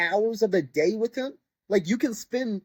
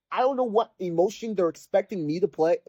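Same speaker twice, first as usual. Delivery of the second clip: normal talking voice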